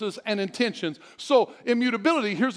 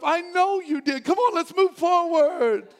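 reassuring; 7 semitones higher, slower than usual. Acted. God voiced reassuring a child.

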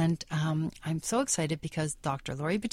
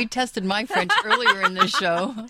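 laughing; 5 semitones higher, 8 dB louder than usual. Real, not acted. Laughing reply.

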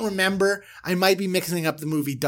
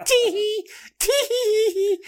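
high giggle; 3 dB louder, much slower than usual. Retold or imitated. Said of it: "giggle imitation".

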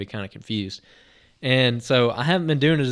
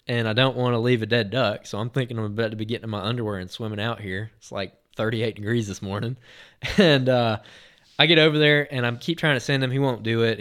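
ordinary talk

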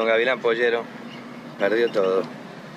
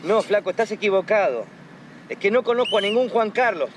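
irritated on phone; 8 semitones higher than usual. Acted. Annoyed phone call, wrong person.

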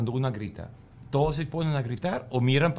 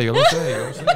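laughing it out, pitch jumping high; giggling knowingly, much higher; 5 dB louder than usual. Real, not acted. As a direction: laugh through the words. Laughing while admitting she knows.

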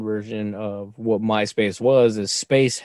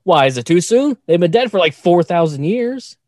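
high, louder teasing question; mock innocent retort; 6 semitones higher, 4 dB louder than usual. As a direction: play dumb to needle her. Retorting to daughter, joking.

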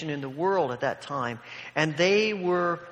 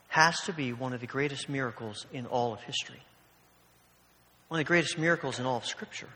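usual speaking voice

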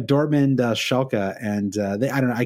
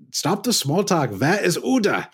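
put-on slow, high-pitched Simpsons German accent; 7 semitones higher, slower than usual. Parent questions Principal Skinner about a child, slow comic German accent. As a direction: demand it in a cartoonish German accent. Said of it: "that is the extent of my, my German accent".

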